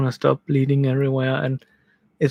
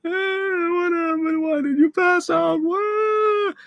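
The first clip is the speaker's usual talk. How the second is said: very high, wailing questions; distraught wife; slower than usual. Retold or imitated. Wife sobbing at her husband on the floor, very high and wailing. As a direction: voice the wife's tearful alarm. Crying wife asks what happened.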